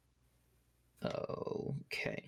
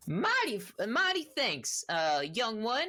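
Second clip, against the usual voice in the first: high-pitched, folksy half-orc rancher; grateful half-orc; 7 semitones higher, 4 dB louder than usual. Acted. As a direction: thank your rescuer in a hammed-up country voice. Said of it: "and he goes".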